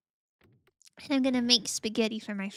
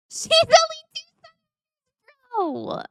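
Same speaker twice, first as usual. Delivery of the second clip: very high, loud laughing cry; laughing squeal; 12 dB louder than usual. Real, not acted. Laughing protest.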